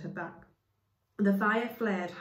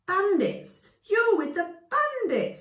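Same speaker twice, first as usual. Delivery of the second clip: high, loud accusing scream; 14 semitones higher, 9 dB louder, slower than usual. Acted. Woman accusing them of bandits, screaming. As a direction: shriek the accusation. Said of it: "screamed the woman".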